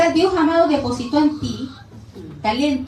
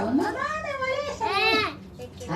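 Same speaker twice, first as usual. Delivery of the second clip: put-on high, soft, very slow whimpering child; a little girl sobbing it to her mom; crying child; 7 semitones higher, 8 dB quieter, much slower than usual. Preacher voicing herself as a crying schoolgirl to her mother. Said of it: "yo llegaba llorando a mi casa".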